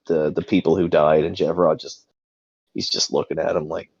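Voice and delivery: normal talking voice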